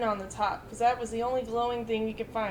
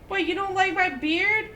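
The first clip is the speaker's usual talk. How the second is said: huffy; 5 semitones higher, 5 dB louder than usual. Retold or imitated. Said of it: "all huffy and puffy".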